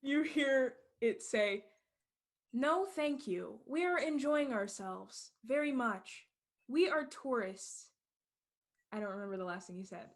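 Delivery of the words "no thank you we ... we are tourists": slow, higher, evenly spaced words; a slow machine translator; translation app voice. Acted. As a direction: spell it out like a stiff translation machine. Phone translates bear's words.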